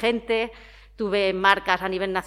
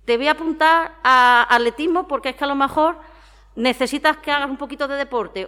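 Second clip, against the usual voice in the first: higher, louder quoted parent voice; imitating pushy parents; 8 semitones higher, 7 dB louder than usual. Parent signing a child up for athletics, imitated pushiness. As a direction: mimic a parent pressing a child. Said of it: "que te dicen".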